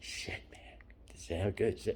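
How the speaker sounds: breathy defeated half-voice, barely there; hushed resigned whisper. Retold or imitated. To himself over the smashed phone, a hushed whisper. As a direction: whisper it like the end has come. Retelling thought after phone broke.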